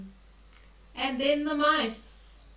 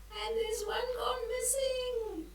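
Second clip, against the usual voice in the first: much higher, wailing mock worry; mock dismay; 12 semitones higher than usual. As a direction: pretend to be upset one is lost. Mock alarm to children.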